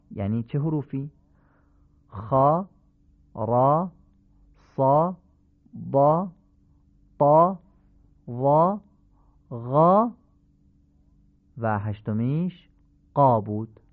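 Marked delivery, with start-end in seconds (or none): 2.1-10.1 each letter alone with a dark, back short 'aw'; full-mouthed 'خا', 'را', 'صا', rising slightly; heavy Arabic fatha. Shown as a demonstration. Teacher listing the eight heavy letters, dark fatha. 13.1-13.4 'قا' with a dark, back short 'aw'; full-mouthed 'qo' for 'قا'; heavy Arabic qaf. Shown as a demonstration. Teacher naming the eighth letter, heavy 'قا'.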